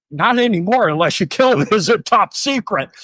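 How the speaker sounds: high, laughing private joke; a sarcastic thought about the top secret base, laughing; inner wisecrack. Retold or imitated. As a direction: crack wise to yourself while laughing. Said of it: "I said on the inside".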